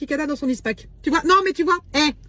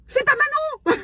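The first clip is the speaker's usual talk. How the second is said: shocked squeal; 12 semitones higher, slower than usual. Real, not acted. Realizes wrong house.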